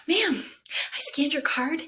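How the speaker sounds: clerk's voice. Retold or imitated. Costco clerk confronts the shopper.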